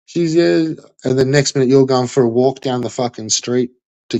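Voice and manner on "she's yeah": higher, whiny mock excuse voice; mocking the streamer's excuse. Ranting viewer mimics the streamer's phone call excuse, mocking high voice. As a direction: parrot his excuse back in a higher, sarcastic sing-song. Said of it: "you're like".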